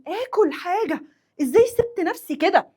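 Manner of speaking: high-pitched acted self-scolding; self-reproach. Hungry worker blaming herself for going without food, self-reproach. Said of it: "بلوم نفسي وبأنب نفسي".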